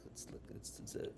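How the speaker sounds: whispered hesitation; reluctant whisper. Real, not acted. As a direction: whisper it as if ashamed to say it. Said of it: "I hate even saying these words".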